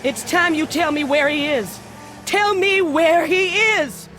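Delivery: from firm to shouted demand; angry demand, building. Acted. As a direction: build up to a furious yell. Shopkeeper demanding.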